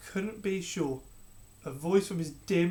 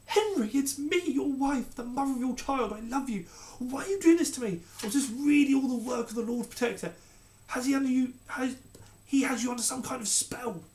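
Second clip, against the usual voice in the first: desperate pleading; 8 semitones higher, 4 dB louder than usual. Acted. Queen pleading while dragged off.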